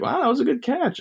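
high put-on uncle voice; uncle's voice.

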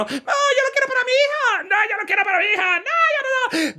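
very high falsetto insistence; quarrelling mother-in-law. Acted. The mother-in-law insists on the son-in-law, very high. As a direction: repeat it high and fast. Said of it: "Y ese pobre yerno".

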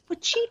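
playful imitated billiard clack; imitated pool shot. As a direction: mimic a cue striking balls, voice flicked high.

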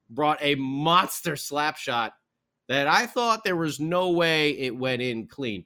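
ordinary talk

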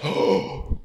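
voiceless gasped oh; mock pained gasp. Storyteller acting out his hit knee, breath-only pained gasp. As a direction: suck in air as if just struck.

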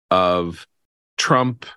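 usual speaking voice

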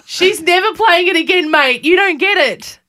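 high, loud mock outrage; indignant retort. Retorts when asked about daughter's punishment.

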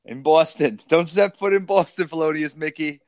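hammed-up Boston accent, playful. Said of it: "our inner Boston accent coming out".

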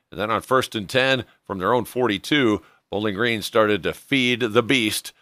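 ordinary talk, nothing marked

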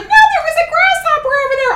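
mock the silly roommate, high; high mocking girl voice. Imitating roommate explaining grasshopper, high mocking voice.

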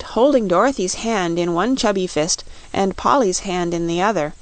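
ordinary delivery, nothing marked

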